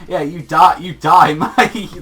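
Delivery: loud, high comic stabbing cry; hammed-up villain jabs on each phrase; mock attacker. Jealous girl stabbing him from behind, mock-menacing. Acting out parody stabbing bad ending.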